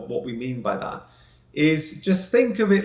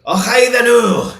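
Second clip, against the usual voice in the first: put-on a quick jokey Glasgow accent, higher and louder; mock Glasgow accent; 6 semitones higher, 9 dB louder, slower than usual. Host joking to her co-host, in a mock Glasgow accent. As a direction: throw in a cheeky Glasgow accent as a gag. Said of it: "That was meant to be Glasgow".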